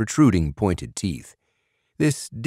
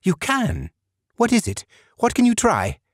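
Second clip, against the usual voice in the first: much higher, rushing questions; eager. A narrator's reading. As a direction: burst with hope. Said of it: "Burgum nodded".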